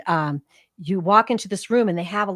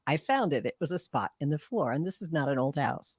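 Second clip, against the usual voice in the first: ordinary talk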